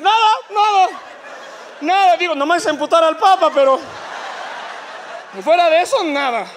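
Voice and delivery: voz aguda y fuerte de negación; señora nerviosa. Acted. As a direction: finge que todo está bien. La señora finge que no pasa nada. (la señora disimulando, agudo y nerviosa)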